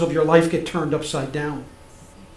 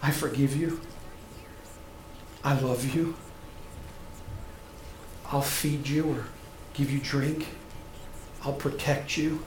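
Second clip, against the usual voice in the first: tender; 6 semitones lower, 14 dB quieter than usual. Acted. Tender Jesus voiced to sinners.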